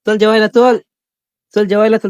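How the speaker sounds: high loud coaxing calls to eat; calling out. Real, not acted. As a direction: call the pet in for food, high and loud. (जेवायला बोलावताना उंच मोठी हाक)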